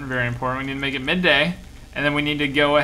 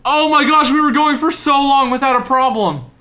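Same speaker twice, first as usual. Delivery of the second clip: high, loud and dismayed; loud high-pitched protest; exasperated outcry; 11 semitones higher, 6 dB louder than usual. Real, not acted. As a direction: cry out at the sudden crash. Crash after a long run.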